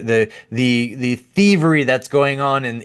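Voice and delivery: ordinary talk, nothing marked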